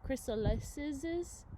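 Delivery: "chrysalises" drawn out slowly, syllable by syllable; slow, careful "chrysalises" with a rising end; sounding out slowly. Shown as a demonstration. Teacher sounding out a hard word.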